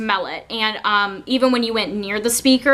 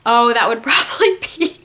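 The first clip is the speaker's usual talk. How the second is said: laughing; 3 dB louder than usual. Real, not acted. Laughing at own silly panic.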